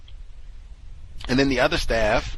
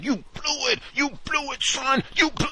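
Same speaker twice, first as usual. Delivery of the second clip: yelling gym coach. Retold or imitated. Quoting her yelling at the student, high mock coach yell. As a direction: bark it like a furious gym coach. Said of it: "me and my yelling".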